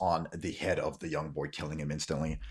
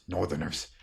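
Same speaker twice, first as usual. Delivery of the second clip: lower, softer echo of disbelief; doubtful older man; 5 semitones lower, 5 dB quieter than usual. Acted. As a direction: repeat it low, disbelieving.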